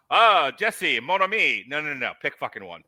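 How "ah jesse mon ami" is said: mock French accent. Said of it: "broken French".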